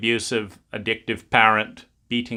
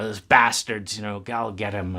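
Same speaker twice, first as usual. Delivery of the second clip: put-on bitter teen; 3 semitones lower, 4 dB quieter than usual. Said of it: "become bitter about that".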